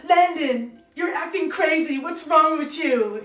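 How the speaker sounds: acting the movie line. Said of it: "And I say".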